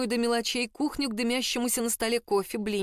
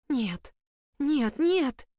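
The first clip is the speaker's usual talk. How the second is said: panicked protest; 5 semitones higher, much slower than usual. A narrator's reading. Emily panics at the mummy.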